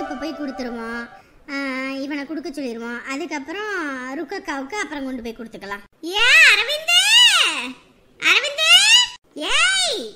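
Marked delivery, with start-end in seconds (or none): 6.1-10.0 loud, high rising call. Acted. Mother calling Aravind, who is not answering, calling out. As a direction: holler the name across a distance. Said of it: "எம்புட்டு நேரம் கூப்பிட்டுக்கிட்டு இருக்கேன்".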